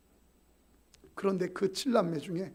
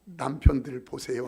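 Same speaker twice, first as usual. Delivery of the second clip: normal talking voice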